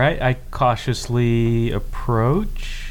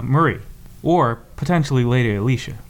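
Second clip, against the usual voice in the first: ordinary delivery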